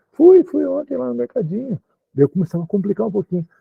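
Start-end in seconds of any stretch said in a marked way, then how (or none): 0.1-1.8 higher, lightly voiced young woman replying; casual reply as the student [name]; [name] answering.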